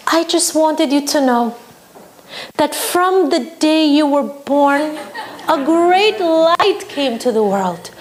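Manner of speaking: slow, sweet doting mother, rising high on matok; gentlest tone. Retold or imitated. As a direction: coo at him like a doting mother. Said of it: "in the gentlest tone".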